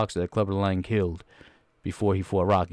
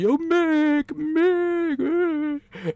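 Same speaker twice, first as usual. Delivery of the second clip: Rocky crying out; much slower than usual. Retold or imitated. Rocky calling out to his dying trainer, high mock wail. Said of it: "Rock's like".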